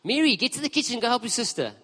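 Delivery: high, sharp bossy order; bossy snap. Retold or imitated. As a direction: order Mary to the kitchen. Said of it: "Most of us would have responded".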